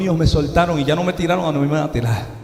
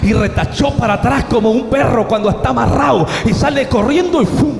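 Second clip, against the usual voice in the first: loud, high-pitched dramatic retelling; urgent, raised storytelling from the pulpit; excited preaching; 7 semitones higher, 4 dB louder than usual. Real, not acted. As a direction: drive the action on at a preacher's full pitch. Preacher dramatizing the lion's charge.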